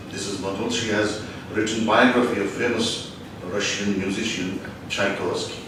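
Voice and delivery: ordinary delivery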